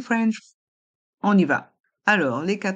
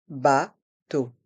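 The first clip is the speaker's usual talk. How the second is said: "Bateau" split into two slow, separate syllables; 4 semitones lower than usual. Shown as a demonstration. Teacher breaking "Bateau" down for a listening quiz, syllable by syllable. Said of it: "Écoutez et choisissez".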